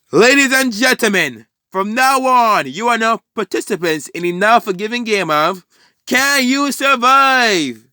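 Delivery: hammed-up villain ringmaster yell; screaming announcer. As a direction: announce it to the whole city. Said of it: "starts screaming at the top of his lungs".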